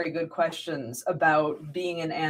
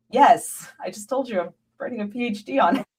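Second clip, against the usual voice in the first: quick, higher, sarcastic thank-you with a laugh breaking in; mock polite exasperation; 6 semitones higher than usual. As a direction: brush them off with fake politeness, chuckling. Retold reply to lecturing Irish locals.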